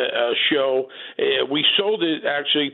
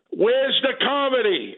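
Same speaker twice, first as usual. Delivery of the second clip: higher, drawn-out mock heckle; imitated heckler's yell; 8 semitones higher, slower than usual. Heckler in the crowd to bombing comedians, mock yell. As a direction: call it out slowly like a heckler. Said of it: "Some guy yells out, a heckler".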